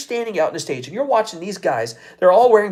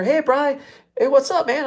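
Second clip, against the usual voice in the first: higher, breezy buddy hello; imitating buddy greeting; 9 semitones higher than usual. Coworker greeting Bri with a pizza, casual. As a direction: play the coworker walking in with pizza. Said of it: "sitting there".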